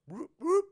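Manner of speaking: high, loud police siren whoops; imitated siren whoop. Storyteller mimics the cop car lighting up, siren whoops.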